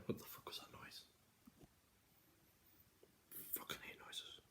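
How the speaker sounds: annoyed whisper. Real, not acted. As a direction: hiss it under your breath. Startled whisper.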